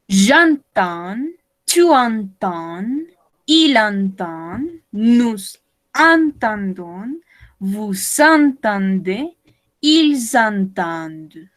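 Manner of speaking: ENTENDS slowly, each nasal vowel held; slow teacher's model of ENTENDONS, nasal vowels stretched; drawn-out conjugation. Shown as a demonstration. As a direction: recite "j'entends, tu entends" very slowly, syllable by syllable. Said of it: "Estos son sonidos nasales".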